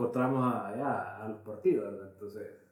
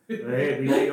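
ordinary delivery

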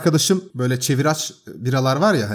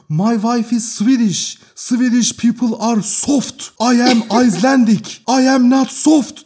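slow, higher, louder mock-menacing English; imitating the Icelandic man; 8 semitones higher, 4 dB louder, slower than usual. Retelling the landlord shouting at the tenants, mock-menacing slow English. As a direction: ham up the big angry Icelander. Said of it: "Herif böyle hani primitive İngilizceyle".

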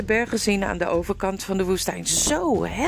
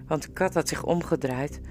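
plain reading voice

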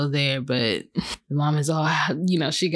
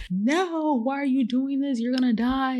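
mock desperate plea, pitched up high; 8 semitones higher than usual. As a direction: beg the character to stop, voice climbing high. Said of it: "and I'm like".

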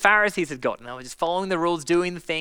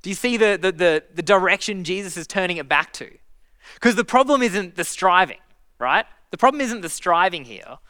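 usual speaking voice

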